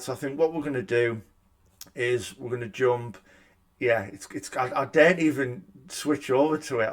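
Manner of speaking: ordinary delivery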